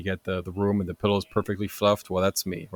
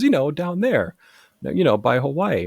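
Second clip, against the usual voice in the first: higher and louder, matter-of-fact cashier; lightly voiced cashier, sure of herself; cashier explaining; 7 semitones higher, 5 dB louder than usual. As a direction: spell it out like it's obvious. Cashier explains Alaska's location.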